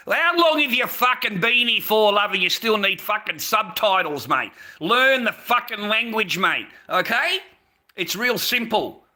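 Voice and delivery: loud, high yelling at a viewer; furious rant. Real, not acted. Yelling at a viewer to learn the language, furious and loud. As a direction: tear into him for needing subtitles.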